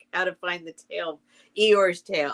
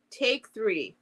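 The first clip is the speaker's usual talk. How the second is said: plain reading voice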